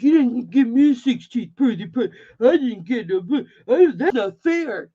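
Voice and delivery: mock whiny teen protest; imitating angry son. Son to mom about his birthday, mildly imitated angry whine. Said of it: "I know my son was pissed".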